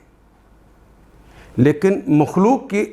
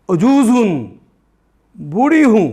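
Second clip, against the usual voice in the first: put-on a touch of a loud, higher old wife's protest; mild imitated cry; 4 semitones higher, 4 dB louder than usual. Sarah crying out to the guests, mildly raised. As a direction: retell her shocked cry, raised a little. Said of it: "تیز آواز سے بولتی ہوئی".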